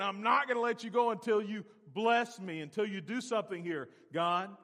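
loud, high desperate plea; cling and beg; Jacob pleading. Acted. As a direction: Jacob begging God not to leave.

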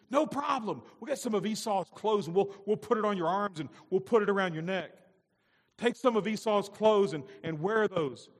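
high, loud conniving mother; scheming Rebecca. Acted. Rebecca coaxing Jacob to trick his father, loud and scheming. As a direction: coax the son with a sly plan. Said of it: "Rebecca's thinking".

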